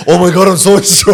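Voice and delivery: high, loud put-on voice through laughter; hammed-up pretend girlfriend, laughing; mock voice laughing. Mocking imagined testosterone effect.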